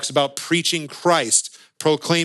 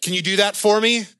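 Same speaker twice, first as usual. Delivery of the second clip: higher adult request; 6 semitones higher than usual. Retold or imitated. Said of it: "the Charlie Brown adult speaking moment".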